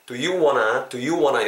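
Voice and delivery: "want to" reduced to "wanna", the t dropped; casual linked "wanna"; reduced fast-speech form. Shown as a demonstration. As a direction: say "wanna" as one word with no t. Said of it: "빨리 발음되면은".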